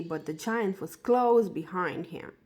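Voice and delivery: usual speaking voice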